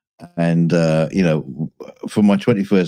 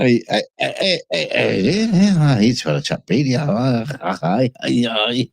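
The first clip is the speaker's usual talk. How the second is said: higher, hammed-up Scottish greetings; mock Scottish lingo; 7 semitones higher, slower than usual. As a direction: play a broad Scottish lad calling hello. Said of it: "so stuck into the lingo".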